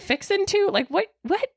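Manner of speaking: high, baffled question; baffled teen. Retold or imitated. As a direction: puzzle over the phrase, disbelieving. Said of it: "And I'm like".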